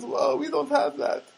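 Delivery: high, slow mock foreign-girl voice; put-on high voice of a foreign shopgirl. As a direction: imitate a foreign counter girl, drawing it out. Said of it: "She's kind of a foreign kind of girl".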